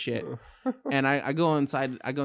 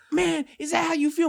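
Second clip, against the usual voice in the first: whiny hurt friend; 14 semitones higher, 3 dB louder than usual. Retold or imitated. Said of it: "and this".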